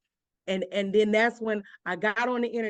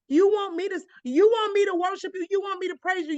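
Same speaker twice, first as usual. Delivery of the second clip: much higher and louder, a raw anguished outcry; crying out at God; 10 semitones higher, 5 dB louder than usual. Retold or imitated. Grieving daughter shouts defiantly at God, raw and high.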